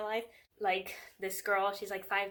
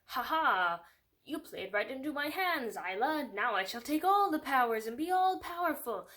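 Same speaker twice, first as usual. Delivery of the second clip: high, hammed-up villain monologue; mock a triumphant evildoer revelling in the reveal; gloating villain; 4 semitones higher, 4 dB louder than usual.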